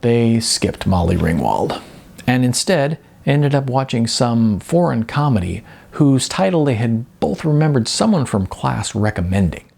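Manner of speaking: plain reading voice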